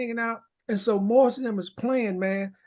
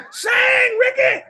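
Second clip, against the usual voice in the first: much higher and louder, yelling across the stage; retold shout; 13 semitones higher, 8 dB louder, slower than usual. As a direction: bellow it like a bandleader egging him on. Prince shouting at him on stage.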